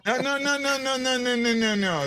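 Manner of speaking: high run of no's, sliding down; teasing protest. Real, not acted. Host refusing the joke excuse, teasing protest. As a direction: rattle off mock-offended refusals, starting high.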